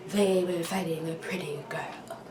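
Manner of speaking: confiding whisper. Retold or imitated. Mom confides quietly.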